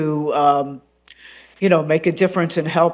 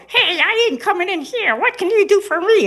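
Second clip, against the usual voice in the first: much higher cartoon voice, cocky; cartoon dog retort; 13 semitones higher than usual. Shown as a demonstration. Gnisi refusing the therapist, high cocky cartoon voice. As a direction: talk back like a cheeky cartoon dog.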